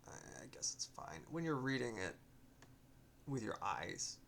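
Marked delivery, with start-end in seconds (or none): 0.1-1.2 whispered aside. Real, not acted.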